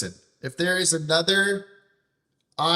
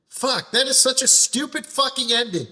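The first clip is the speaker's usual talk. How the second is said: angry outburst; 6 semitones higher than usual. Real, not acted. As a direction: let the anger burst out at the game. Ranting about game's ending.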